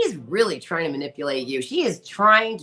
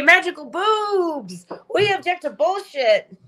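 giddy cheer; 10 semitones higher, 4 dB louder, slower than usual. Real, not acted.

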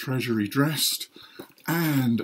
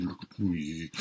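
"look at me" dropped lower and softer; "look at me" muttered to itself; quiet aside; 5 semitones lower, 6 dB quieter, slower than usual. Acted. Repeats it dropping away.

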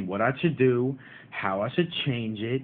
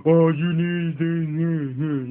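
slow, louder, mocking know-it-all; troll way; 2 semitones higher, 4 dB louder, much slower than usual. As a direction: drag out the Oh and hammer each this like a snotty commenter. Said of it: "Y'all don't gotta say it in a troll way".

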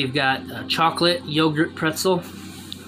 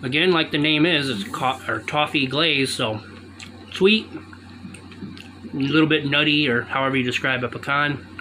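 muffled behind hand; chewing while speaking; talking with mouth full. Real, not acted. Seen 5: Fist covers mouth while chewing pecan throughout labelled words.

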